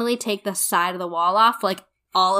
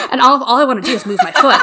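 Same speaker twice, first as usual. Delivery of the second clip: quick, louder and laughing through it; 5 semitones higher, 7 dB louder, faster than usual. Real, not acted. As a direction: giggle out the urge to move. Laughing while telling scare.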